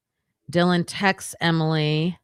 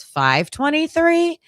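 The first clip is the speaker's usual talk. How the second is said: sharply higher, slow, disbelieving echo of the time; incredulous question; 10 semitones higher, 4 dB louder, slower than usual. Retold or imitated. Detective checking a time in disbelief, incredulous. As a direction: echo the number back as if it can't be right.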